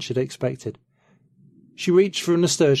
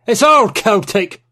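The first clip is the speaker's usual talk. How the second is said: put-on high, loud and slow, an indignant old man's cry; exclaiming; 10 semitones higher, 8 dB louder, slower than usual. Old Cornishman protests at the dinner table, loud exclamation. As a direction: drag it out in outraged protest. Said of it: "Penhallow exclaimed".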